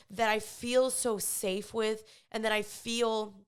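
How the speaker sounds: ordinary delivery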